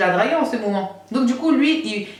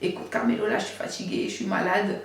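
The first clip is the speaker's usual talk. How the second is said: softer, half-whispered sick voice; fake sick voice; 9 dB quieter than usual. Faking illness to get Carmelo off the phone, softer and weak. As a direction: sound weak and ill on purpose. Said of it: "j'ai fait ma voix de malade".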